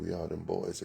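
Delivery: normal talking voice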